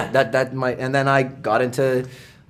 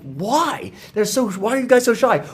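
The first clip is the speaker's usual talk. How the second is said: bewildered questioning; 9 semitones higher than usual. Retold or imitated. Retells his bewilderment at shy fans.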